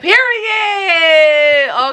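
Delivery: high loud drawn-out period; loud emphatic period. Retold or imitated. Declaring she is done, high loud shout. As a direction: shout it as the final word.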